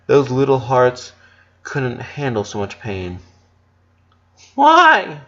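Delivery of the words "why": high, loud anguished why; tearful cry. Acted. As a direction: cry it out, hurt and pleading. Lola crying, wiping her face.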